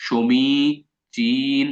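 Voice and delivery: সমীচীন drawn out very slowly, long ী vowels held; very slow stretched reading. Shown as a demonstration. Teacher drags out সমীচীন so the spelling sticks. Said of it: "মানে টাইনা টাইনা পড়বেন".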